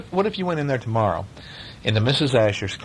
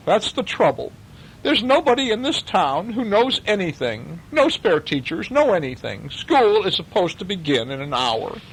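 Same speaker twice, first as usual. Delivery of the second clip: raised, fretting official; a harried school head at his wits' end; lightly voiced flustered superintendent; worried superintendent; 9 semitones higher than usual. A narrator's reading.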